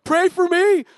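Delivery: mock tearful plea, high and loud; imitated crying. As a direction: wail it out, imitating a friend in tears. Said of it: "he starts crying".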